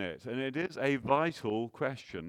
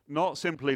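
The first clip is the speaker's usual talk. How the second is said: "Not" hit higher and louder; stress on not; 4 semitones higher, 4 dB louder than usual. Shown as a demonstration. Preacher to congregation, hitting "Not" hard. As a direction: punch the word "Not" in "Not simply". Rhetorical list of negations.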